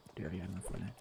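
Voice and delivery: quiet self-confirming mutter; muttered under breath. Real, not acted. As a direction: mumble it as a private reminder.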